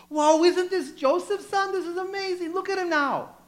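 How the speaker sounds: high, loud, delighted exclaim; enthusiastic. Acted. Congregation praising Joseph's son, enthusiastic and delighted. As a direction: gush with amazement about him. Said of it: "going enthusiastically".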